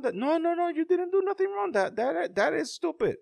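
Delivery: very high, hurried daddy voice; reassuring father. Retold or imitated. Dad assuring his daughter she did nothing wrong, reassuring. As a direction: imitate a dad hastily soothing his child. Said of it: "and he told her".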